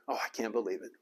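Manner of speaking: normal talking voice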